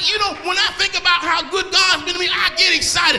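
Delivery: rising fervent sermon delivery; preaching. Acted. Preacher rousing the congregation, fervent. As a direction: build the energy, call them up. Preacher builds to full force.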